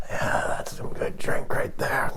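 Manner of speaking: slow, whispery radio-guy cool; cool made-up voice. Acted. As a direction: hush it right down and draw it out. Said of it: "rather than my real voice".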